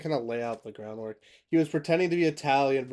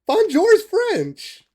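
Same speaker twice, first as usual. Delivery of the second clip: much higher, louder, slowed exclaim; laughing correction; 12 dB louder, slower than usual. Real, not acted. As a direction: blurt out the obvious truth, cracking up. Realises he greeted in Italian.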